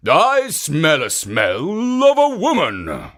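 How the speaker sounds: loud, slow, suspicious ogre voice; suspicious ogre crying out. A narrator's reading. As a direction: sniff and call out like a wary ogre.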